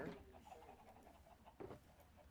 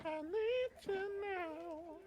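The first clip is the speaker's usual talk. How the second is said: mocking falsetto wail; much slower than usual. Mocks the plea in high voice.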